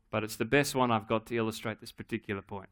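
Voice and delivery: normal talking voice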